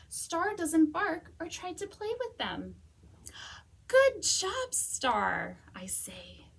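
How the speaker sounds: lively, expressive storybook reading; animated picture-book read-aloud; reading to children. Acted. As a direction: read it like story time for kids.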